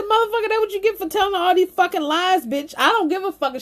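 high, rapid-fire scolding; angry telling-off. Retold or imitated. She tells him off for lying, fast and angry.

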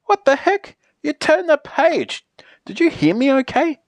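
high-pitched, startled disbelief; affronted and incredulous; indignant surprise. Acted. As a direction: jump up high, shocked they ignored you. Indignant at the page being turned.

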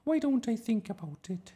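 very high, noncommittal teacher voice; hesitant teacher. A narrator's reading. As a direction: put the child off in a high voice. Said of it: "Miss Darling hesitated".

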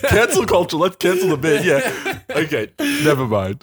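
high, giggling through the words; laughing. Real, not acted. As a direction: laugh it off after the joke. Laughing after fake sting joke.

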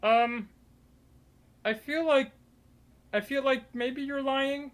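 high, unsure Jerry fishing for a lie. Acted. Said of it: "and he goes".

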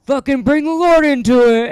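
loud, high heckler jeer; heckler's jeer. Retold or imitated. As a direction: sneer it back at the comic like a drunk heckler. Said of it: "And he goes".

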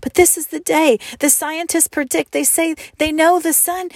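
put-on high, pleading little-girl voice. A narrator's reading. Margot pleading with the boy that the sun comes. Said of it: "Margot whispered".

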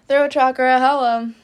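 a sudden loud quip; mock exclamation. Real, not acted. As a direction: playfully shout at your own throat. Cough interrupts her reading.

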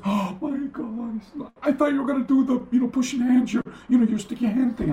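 high, dismayed voice; aghast friend. Retold or imitated. The host realising what was taught, high and aghast.

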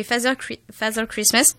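Father Christmas in a soft put-on English accent; English pronunciation of Father Christmas, said lightly. Shown as a demonstration. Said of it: "avec mon bel accent".

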